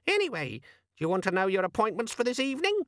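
aggrieved little gadget; angry demon. A narrator's reading. Organizer demon complaining to Vimes, squeaky and angry.

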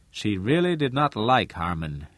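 plain reading voice

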